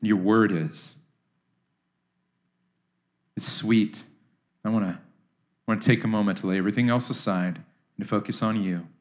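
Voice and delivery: soft, low prayer voice; hushed closing prayer; quiet prayer. Real, not acted. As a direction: pray it gently and inwardly.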